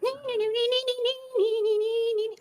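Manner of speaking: tiny squeaky-high nonsense chant. Acted. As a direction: babble way up high in a put-on little voice. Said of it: "a higher pitched voice, so do you wanna be like".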